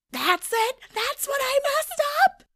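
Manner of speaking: high, loud incredulous shriek; acting out stunned indignation; outraged disbelief. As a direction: screech it like you've been robbed. Outraged at losing over one letter.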